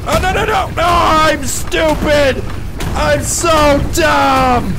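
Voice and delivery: high, drawn-out wail of regret; loud, anguished self-reproach; self-berating shout. Real, not acted. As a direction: yell at your own mistake. Berating self after mistake.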